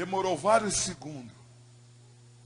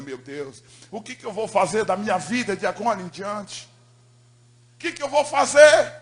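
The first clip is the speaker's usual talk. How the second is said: desperate inner cry; 3 semitones higher, 10 dB louder than usual. Acted. Desperate inner cry, rising to loud.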